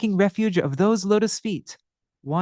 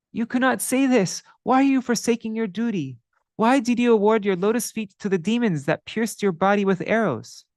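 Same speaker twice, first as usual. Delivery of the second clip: high, urgent protest; the sinner Madhai challenging the Lord, voice raised high; indignant pleading sinner; 5 semitones higher than usual. Acted. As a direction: press the Lord with hurt questions.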